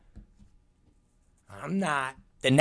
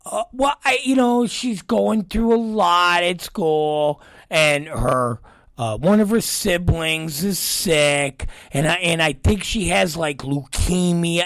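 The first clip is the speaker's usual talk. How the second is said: hesitant teacher; 4 semitones higher than usual. Retold or imitated. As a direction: stall and draw out the excuse. Imitating hesitant teacher making excuses.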